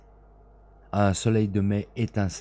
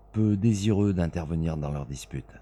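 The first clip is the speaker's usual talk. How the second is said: plain reading voice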